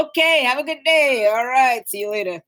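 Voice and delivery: high, sugary goodbye at the door; sweet send-off. Retold or imitated. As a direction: sweetly wave him off to work. Wife seeing husband off.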